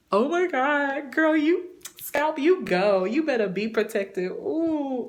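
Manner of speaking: high, excited squeal of pride, slowed on the praise; overjoyed. Retold or imitated. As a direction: cheer your own scalp on like a proud friend. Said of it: "but I was so happy".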